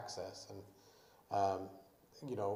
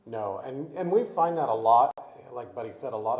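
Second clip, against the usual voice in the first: loud, nearly yelling; raised voice; 2 semitones higher, 12 dB louder than usual. Real, not acted. Board member answering over a noisy thing, raised voice. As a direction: talk loudly over the equipment. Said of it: "I feel like I'm yelling".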